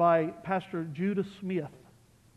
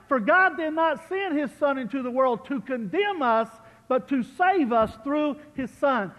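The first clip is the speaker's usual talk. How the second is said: fervent pulpit recitation; preached scripture; 6 semitones higher, 7 dB louder than usual. Acted.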